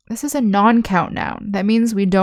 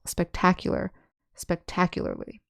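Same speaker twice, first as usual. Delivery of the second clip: "spectacular" and "spectacularly" with the stress on TAC; 7 dB quieter than usual. Shown as a demonstration. Teacher showing students "spectacular" stressed on the second syllable. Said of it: "the accent is on the second syllable".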